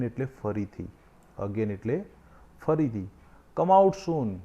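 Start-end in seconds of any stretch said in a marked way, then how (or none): 3.6-4.4 shout. Acted. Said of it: "Chhotu shouted again".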